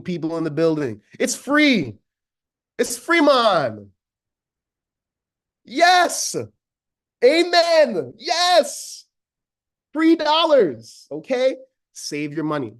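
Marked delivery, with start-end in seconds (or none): 1.2-3.9 excited. Real, not acted. Insisting templates are free. 5.6-11.6 high, loud church-call "Amen"; preacher-like joy. Real, not acted. Preacher-style rejoicing over savings.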